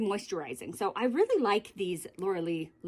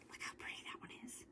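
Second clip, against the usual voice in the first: whisper; 22 dB quieter, faster than usual. Real, not acted. Admiring a favourite lip gloss.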